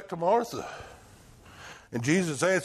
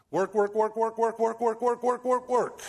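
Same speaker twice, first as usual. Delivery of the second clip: rapid-fire monotone rattle creeping up in pitch; mock busyness, one word hammered evenly; relentless repetition; 5 semitones higher than usual. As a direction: chug through it like a machine. Mimics endless busyness in repetition.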